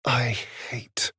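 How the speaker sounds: grudging whispered start. Acted. Admitting a companion is right, grudging whisper. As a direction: breathe it out reluctantly.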